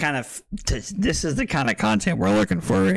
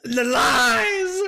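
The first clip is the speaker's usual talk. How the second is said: drawn-out, high cry of betrayal; raised, slow protest; mock outrage; 4 semitones higher, much slower than usual. Real, not acted. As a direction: wail it like you've been cheated. Mock outrage at being tricked.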